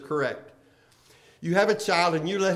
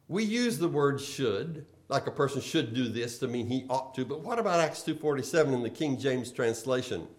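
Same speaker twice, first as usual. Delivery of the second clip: ordinary talk, nothing marked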